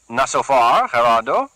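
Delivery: a keen henchman keen to use the cleaver; Carlos, eager thug. Acted. Carlos offering his boss a fix, eager.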